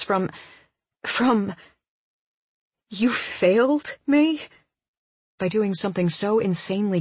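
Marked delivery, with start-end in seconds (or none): 2.9-4.4 high, slow disbelief; can't believe what she hears; incredulous. Acted. Stunned she disbelieves his apology.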